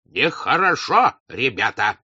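slow, high rebuke that drops at the end; admonishing. A narrator's reading. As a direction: chide the men with new authority. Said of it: "сказал Алпатыч, вступая в свои права".

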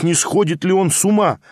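usual speaking voice

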